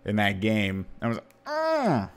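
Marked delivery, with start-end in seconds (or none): 1.5-2.1 high strained grunt like pushing; straining grunt. Retold or imitated. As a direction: push the sound out hard. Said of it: "like that".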